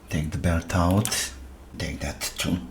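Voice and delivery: ordinary talk, nothing marked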